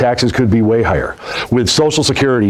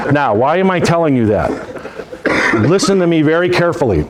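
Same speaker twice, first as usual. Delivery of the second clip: ordinary talk, nothing marked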